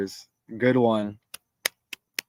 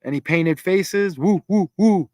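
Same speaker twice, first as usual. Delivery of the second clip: party cheering; 4 semitones higher, 5 dB louder, slower than usual. Acted.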